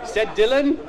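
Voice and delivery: put-on high-pitched kids' TV storyteller; mock children's story narration. Comedian reading a Magic Roundabout script, childlike storytelling voice. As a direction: read it high and simple, as if to little children.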